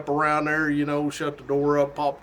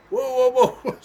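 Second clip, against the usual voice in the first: startled whoa; 9 semitones higher, slower than usual. Retold or imitated. Startled, laughing at fright.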